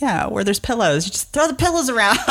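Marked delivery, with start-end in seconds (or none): 1.0-2.3 giddy laughing. Real, not acted.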